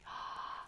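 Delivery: sharp unvoiced gasp of surprise; surprised gasp. Reader gasps at the kiss.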